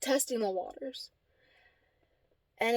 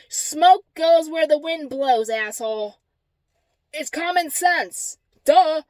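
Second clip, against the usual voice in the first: emphatic, slow, higher-pitched retort; scornful lecture ending in a loud duh; exasperated retort; 4 semitones higher, 5 dB louder, slower than usual. Retold or imitated. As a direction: spell out the obvious, exasperated. Retold retort in argument.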